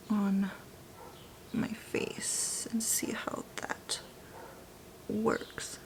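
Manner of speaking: breathy whisper while dabbing on makeup; hushed aside while concentrating. Real, not acted. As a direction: whisper it under your breath. Quietly dotting foundation on.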